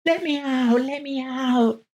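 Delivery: very high thin squeak, pleading; 아주 높고 가늘게 외치는 목소리; squeaky voice. A narrator's reading. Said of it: "a squeaky voice came".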